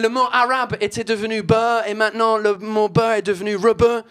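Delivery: French spoken with a thick put-on English accent. Comedian on stage hamming up an English accent. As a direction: play the Brit mangling French vowels. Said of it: "cet accent de merde".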